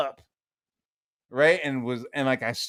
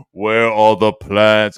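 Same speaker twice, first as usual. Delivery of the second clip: slow, loud, lowered villain demand; Darth Vader; 4 semitones lower, 9 dB louder, slower than usual. Retold or imitated. Dad interrogating son like a movie villain, slow loud impression. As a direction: draw it out, commanding and booming. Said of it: "like Darth Vader asking for the plans".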